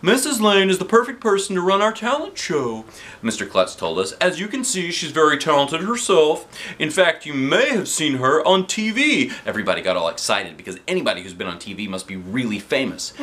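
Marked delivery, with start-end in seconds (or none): 0.0-2.9 principal voice. A narrator's reading. Voicing the principal Mister Klutz. 4.1-9.3 principal voice. A narrator's reading.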